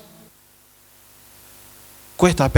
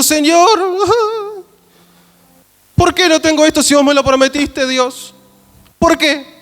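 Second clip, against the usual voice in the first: pleading believer; 9 semitones higher than usual. Pretend. An imagined brother crying out to God, high and pleading. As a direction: cry out to God like a desperate brother. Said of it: "un hermano llorando y diciendo".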